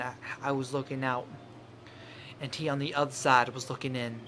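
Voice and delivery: poem reading. Acted. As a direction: read it like a haunting confession, softly. Reads the poem aloud softly.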